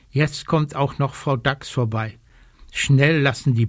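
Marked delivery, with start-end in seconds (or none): none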